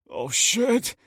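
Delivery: high startled curse; a sudden panicked oath; shocked curse. Acted. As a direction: blurt it out in horror. Trayvon sees he shot Ryan.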